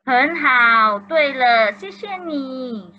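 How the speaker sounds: ordinary delivery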